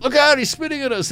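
high, alarmed yelp of a fleeing reporter; mock panic. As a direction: squeal it like you're dodging spit. TV crew fleeing from spit.

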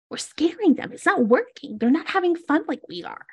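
high-pitched little creature voice; little creature, earnestly complaining; small excitable creature companion. Acted. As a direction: chatter high like a small mischievous critter.

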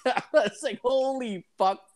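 high, slow laughing gasp; laughing disbelief. Real, not acted. Telling his friend about dad's answer, laughing high. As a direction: laugh through the shock.

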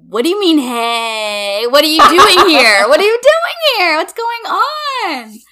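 high, loud mock astonishment; shocked disbelief. Retelling seeing Jesse arrive, acting astonished shock. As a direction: shriek it like you can't believe she's here.

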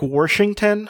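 Washington with an R, as "Worshington"; drawn-out "Warshington"; added R. Shown as a demonstration. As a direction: slip an R into the first syllable of Washington. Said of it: "they put R's in places".

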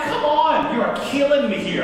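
high-pitched exasperated protest; worked-up retold complaint; mad. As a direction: plead in annoyed disbelief. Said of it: "I'm mad".

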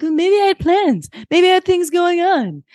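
high, whiny mock-teen protest; pissy teen. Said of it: "Rory is pissy about dinner".

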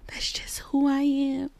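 a whispered confession about herself; whispered aside. Real, not acted. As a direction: whisper it, unvoiced. Quiet aside about her habits.

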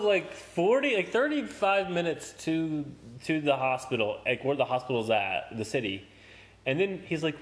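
ordinary talk, nothing marked

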